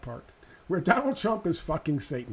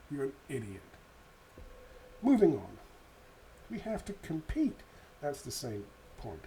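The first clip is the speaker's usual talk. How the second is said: normal talking voice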